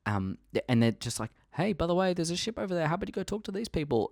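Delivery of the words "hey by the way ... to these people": high squeaky creature voice; mock whale voice. Purgle whale pointing kids to a ship, high mock creature voice. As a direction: voice a friendly space whale. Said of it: "they say that in Whale".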